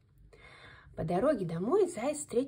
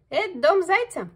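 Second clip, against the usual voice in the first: high, loud mole voice; mole asking; 8 semitones higher, 10 dB louder than usual. A narrator's reading. Mole pops up from the cellar, asks loudly. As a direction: perk up and call the question up high. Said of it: "спросил он".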